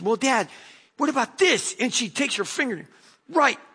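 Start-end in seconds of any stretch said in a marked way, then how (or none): 0.0-1.8 daughter's voice. Retold or imitated.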